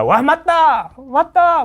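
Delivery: high-pitched mock cheer of agreement; swept-up crowd agreeing. Host acting out incited students, excited mock agreement. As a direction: cry out like an incited follower. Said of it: "선동이 되잖아".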